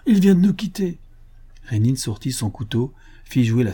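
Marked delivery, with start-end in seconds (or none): 0.1-1.0 much higher, louder disbelief; stunned outcry; shocked protest. A narrator's reading. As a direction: refuse to believe he is dead.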